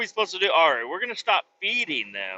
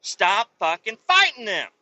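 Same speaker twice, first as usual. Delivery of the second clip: stern scolding; 5 semitones higher, 6 dB louder than usual. Retold or imitated. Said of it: "He's like".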